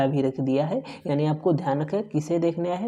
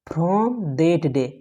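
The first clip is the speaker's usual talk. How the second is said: slow reading; much slower than usual. Shown as a demonstration. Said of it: "F R O M".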